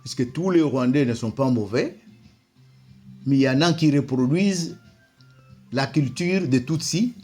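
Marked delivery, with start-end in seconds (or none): none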